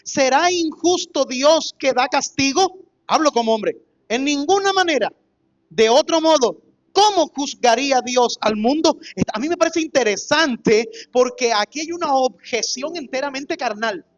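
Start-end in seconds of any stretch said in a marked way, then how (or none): 0.0-9.0 loud, declaiming Bible reading; proclaimed Bible passage; scripture reading. Acted. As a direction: read the verses out to the church.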